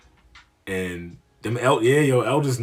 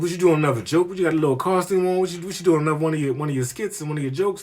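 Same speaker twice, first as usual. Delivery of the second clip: old head mocking his outfit, voice raised high; teasing old head; 6 semitones higher than usual. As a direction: poke fun at the costume. Old head teasing about costume.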